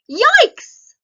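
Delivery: shrieked high and loud yikes; a big startled yelp; sudden high startled cry. Shown as a demonstration. Teacher shows exclamation word.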